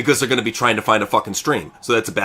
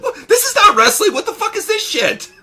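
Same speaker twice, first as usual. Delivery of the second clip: much higher, laughing mock disbelief; incredulous; 3 dB louder than usual. Retelling his reaction to the co-host, high and laughing. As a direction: squeal out his own outraged reaction. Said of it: "I'm like".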